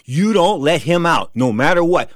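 retold stern command to a guard; firm order. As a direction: lay down the rule deliberately. Orders guard, bribing him.

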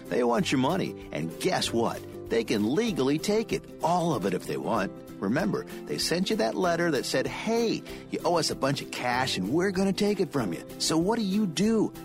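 normal talking voice

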